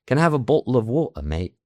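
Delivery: demonstrated everyday British accent, Ts swallowed; British stop T accent. Shown as a demonstration. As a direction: drop every T, British-style, through to "mate". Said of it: "la stop T".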